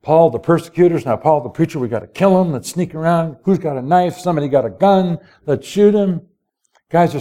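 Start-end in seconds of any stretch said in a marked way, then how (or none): none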